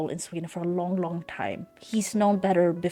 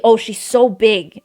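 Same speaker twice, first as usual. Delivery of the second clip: mocking dad; 3 semitones higher, 11 dB louder than usual. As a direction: ham up his gawking remark. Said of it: "Or she".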